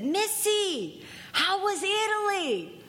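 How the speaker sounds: high mock voice for John; a lightly voiced sponsor asking; Russian John. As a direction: pitch up to play the old-timer. Russian John voiced in retold talk.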